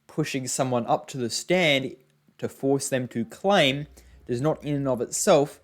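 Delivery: ordinary talk, nothing marked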